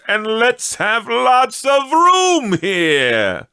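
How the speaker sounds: put-on high, loud, slow hearty old master's voice; jolly old employer calling out. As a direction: boom it out like a merry old boss rallying his lads. Employer Fezziwig calls out to apprentices.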